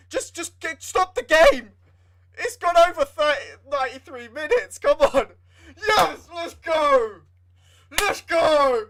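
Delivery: high, loud cry of relief and joy; jubilant shout. Real, not acted. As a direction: scream it as the whistle goes. Final whistle, team wins.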